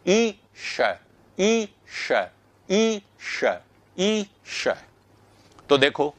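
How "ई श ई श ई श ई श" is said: ई then श, high pitched, gliding from ee into a palatal sh; ee-sh drill. Shown as a demonstration. Teacher drills the class on ई श. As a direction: glide ई straight into श. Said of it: "वहीं से जीभ को ऐसे ही करके बोलिए".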